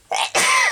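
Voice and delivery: sudden cough-sneeze burst; real sneeze; sneezing. Real, not acted. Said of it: "I think I'm gonna sneeze".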